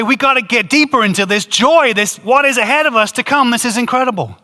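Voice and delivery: high, bright burst of joyful eagerness, laughing through it; excited joy. Pretend. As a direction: gush like someone who can't get enough. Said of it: "'Cause you're gonna say".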